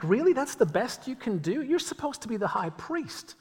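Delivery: teasing the high priest with mock disbelief; incredulous scoff. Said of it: "You're like".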